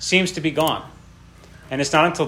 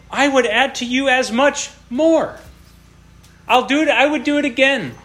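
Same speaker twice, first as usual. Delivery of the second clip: high, swelling voice of God; God's lavish promise; 10 semitones higher, 3 dB louder than usual. Acted. Preacher to the congregation, voicing God offering more, high and swelling. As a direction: pour out the promise like a giving father.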